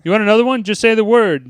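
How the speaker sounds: a stern principal goading a student; angry teacher. Acted. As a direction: push him, daring him to talk back. Vernon escalating at Bender.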